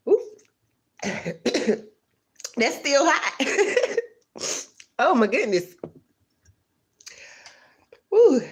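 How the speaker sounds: talking round hot food; words around a hot mouthful; mouth full of hot food. Real, not acted. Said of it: "I just burnt my tongue".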